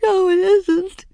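high, loud, slow sobbing protest; weeping. A narrator's reading. Ramona to comforting mother, loud weeping protest. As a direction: wail it through tears like a child. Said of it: "wept Ramona".

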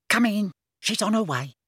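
put-on high-pitched young girl's voice; scruffy helper girl. A narrator's reading. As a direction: let the visitor in, pitched high. Scruffy girl admits the visitor.

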